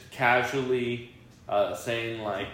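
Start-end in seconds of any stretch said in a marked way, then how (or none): none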